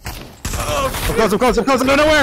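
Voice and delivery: panicked. Real, not acted. Panicked in a chase.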